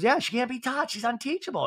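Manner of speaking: high-pitched put-on voice; Kyle. Housewife agreeing her friend is hopeless, high impression. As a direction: chime in high, mimicking her.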